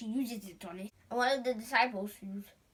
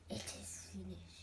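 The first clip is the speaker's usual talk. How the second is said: solemn unvoiced whisper; Jesus' last words; 3 semitones lower, 13 dB quieter than usual.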